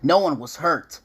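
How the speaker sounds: ordinary delivery, nothing marked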